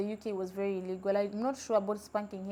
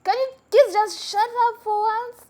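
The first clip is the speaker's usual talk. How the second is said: put-on much higher, louder exasperated yell at the kids; fed-up shout; 12 semitones higher, 9 dB louder than usual. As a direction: snap at noisy kids on the bus. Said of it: "she stood up and she was like".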